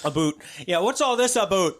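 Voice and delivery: playful Canadian impression in "what's all this about"; mock Canadian accent. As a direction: ham up a Canadian "about" as "aboot". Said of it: "I can't even do a Canadian accent".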